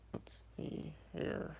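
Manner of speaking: slow, hushed whisper; whispering to self. Real, not acted. Streamer to self while looking something up, whispered. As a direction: whisper it slowly under your breath.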